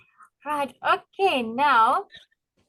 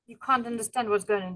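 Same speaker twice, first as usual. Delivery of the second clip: ordinary talk, nothing marked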